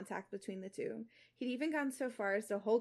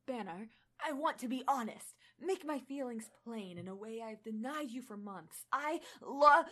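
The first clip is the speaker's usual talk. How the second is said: put-on a touch of an Australian accent, confessing. Reader voicing Thor in a faint Australian accent. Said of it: "still attempting it".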